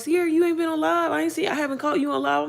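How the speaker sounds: high whiny fan complaint; a lightly voiced needy viewer; mimicking fans. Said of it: "people hitting me up talking about".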